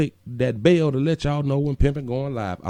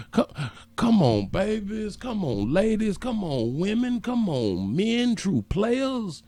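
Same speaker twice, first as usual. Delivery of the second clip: singsong plea, pitch swinging up on each group; repeated swooping appeal, drawn out at the end; coaxing; 5 semitones higher, slower than usual. Real, not acted. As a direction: cajole them in a rolling rhythm. Coaxing viewers to hit thumbs up.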